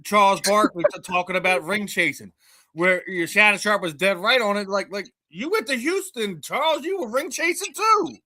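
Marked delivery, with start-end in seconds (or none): 5.3-8.2 high-pitched, incredulous mock accusation; retold call-out. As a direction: call him out, voice jumping way up. Retold call-out of Charles Barkley.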